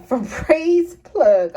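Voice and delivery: slow, laughing over a stumbled product name; laughing through it. Real, not acted. Vlogger stumbles on the product name, laughing. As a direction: giggle as the word trips you up. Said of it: "I can't even get it out, y'all".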